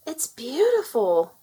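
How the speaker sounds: slow, breathless wonder at a gift; gasping delight. A narrator's reading. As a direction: gasp it out in amazement. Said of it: "Ariel gasped".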